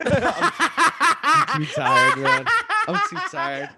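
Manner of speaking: high, loud laughing words; real laughing fit over the words; laughing while talking. Real, not acted. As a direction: squeal it out through helpless laughter.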